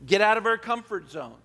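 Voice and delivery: ordinary talk, nothing marked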